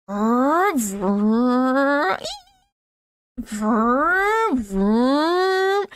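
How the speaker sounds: high, loud revving engine vroom; loud engine noise impression, rising and falling; car impression. Shown as a demonstration. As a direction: roar it out like a racing engine. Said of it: "do you guys like my car impression".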